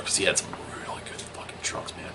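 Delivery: hushed, breathless conspiratorial aside; whispered aside. Acted. Host whispers to viewers about the dealer's drugs. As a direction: lean in and whisper the punchline.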